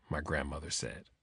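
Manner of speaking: plain reading voice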